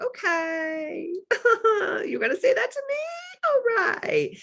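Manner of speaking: hammed-up incredulous shriek; mock shocked squeal. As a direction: squeal it in disbelief. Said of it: "And I was like".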